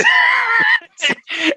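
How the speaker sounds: loud, high shout of laughter. Real, not acted. Cohost laughing at the swing-and-a-miss joke, loud high laugh. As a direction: burst out laughing loudly.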